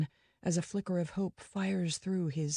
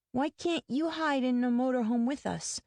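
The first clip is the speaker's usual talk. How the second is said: put-on higher, childlike voice; a boy pleading with his mom; young boy; 7 semitones higher than usual. A narrator's reading. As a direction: play the small boy, anxious. Young boy asks his mother.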